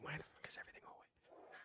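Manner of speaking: hissed mock whisper; annoyed dad whispers at the wet bathroom; whispered exasperation. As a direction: whisper it like a frustrated parent. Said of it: "You're like".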